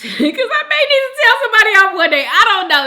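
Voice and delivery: high, loud and quick through laughter; laughing. Real, not acted. Host joking with viewers about a promise, laughing. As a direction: blurt it out while cracking up.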